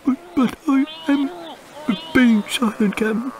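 very high, stammering protest; flustered mate. Acted.

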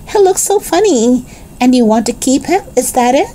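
high-pitched, mildly tickled lady; amused lady. A narrator's reading. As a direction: lift the pitch with understated amusement.

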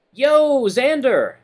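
high, loud shout over chatter; yelled greeting. A narrator's reading. Friend hailing Xander over the cafeteria chatter, yelling. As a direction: yell to a friend across a busy room. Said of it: "He nearly has to yell".

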